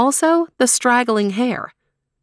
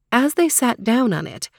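usual speaking voice

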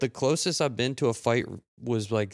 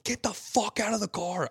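high, raised retold yell; angry demand; 10 semitones higher than usual. Retelling pulling the driver from his car, high angry yell. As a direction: bark it at the driver through his window.

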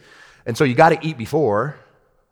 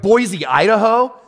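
incredulous question; 7 semitones higher, 3 dB louder, slower than usual. Retold or imitated.

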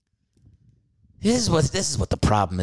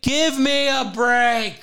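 high, slow, drawn-out exasperated cry; mock outrage; 14 semitones higher, much slower than usual. Husband mocking his wife's complaint, high drawn-out outrage. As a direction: stretch it out in mock disbelief. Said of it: "I was like".

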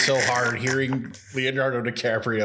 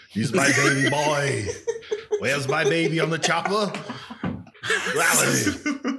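a loose, slightly raised Arnold voice, laughing through it; Arnold Schwarzenegger impression; 5 semitones higher than usual. Trivia guest does his so-bad-it's-good Arnold impression. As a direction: ham up a bad Arnold for friends. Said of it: "so [name] can do it in the voice".

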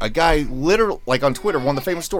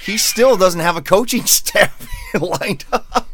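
slow, breaking into laughter mid-sentence; laughing mockery; 8 semitones higher, slower than usual. Real, not acted. Fan scoffing at a coach without a staff, laughing. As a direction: laugh helplessly toward the end.

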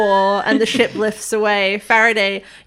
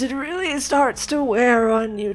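ancient alien grumbling about age; creaky old-timer; 4 semitones higher than usual. Acted. Elderly alien complaining about age.

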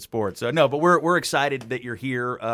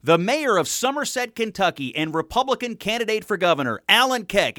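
high, loud radio announcer read; 9 semitones higher, 6 dB louder than usual. Acted. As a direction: present the guest like a broadcast intro. Announcer intro of guest.

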